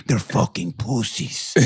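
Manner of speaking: low, slow Ukrainian woman's voice; imitated mannish woman. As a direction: imitate her talking like a man. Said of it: "she was like a man".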